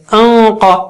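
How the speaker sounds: sustained heavy hum released into قا; heavy ghunna. Shown as a demonstration. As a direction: hold the n of انقا long and full. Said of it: "نون ساکنہ بھی اونچا ہو جائے گا".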